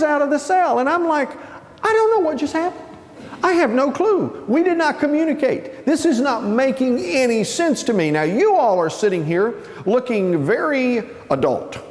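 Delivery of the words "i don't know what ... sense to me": high-pitched, baffled protest; mock bewilderment. New inmate baffled as cellmate leaves, high bewilderment. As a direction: plead total confusion way up high.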